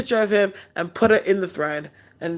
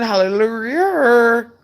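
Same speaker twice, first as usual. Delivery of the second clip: drawn-out hallelujah; 4 semitones higher, 3 dB louder, much slower than usual. Acted. Mock praise after "Amen.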